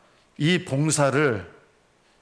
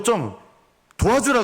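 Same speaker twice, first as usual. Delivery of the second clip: high, louder irritated plea; irritated Martha; 8 semitones higher, 3 dB louder than usual. Retold or imitated. Pastor voicing Martha's irritation at her sister. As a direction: snap at her sister to help. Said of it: "짜증이 확 올라왔습니다".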